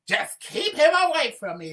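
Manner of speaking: high loud panicked plea. Acted. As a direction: shriek like a frantic damsel.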